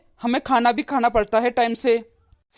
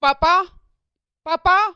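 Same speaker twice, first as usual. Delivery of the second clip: loud, high call across the house; 6 semitones higher, slower than usual. Acted. Son shouting for his father across the house, loud high call. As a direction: shout for papa from the next room. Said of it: "अरे चिल्ला क्यों रहा है?".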